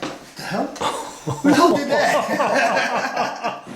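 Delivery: high, laughing disbelief, stretched slow; half-laughing, spooked and amazed; startled disbelief. Retold or imitated. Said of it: "I'm like".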